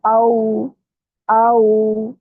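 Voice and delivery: आओ as a drawn-out 'a-oo'; soft rounded glide. Shown as a demonstration. Teacher models waw leen, soft rounded glide. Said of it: "इनको नरमी से अदा करते हैं".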